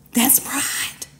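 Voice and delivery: hushed whisper. Real, not acted. Preacher whispers the point to the congregation. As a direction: drop to a whisper to land it.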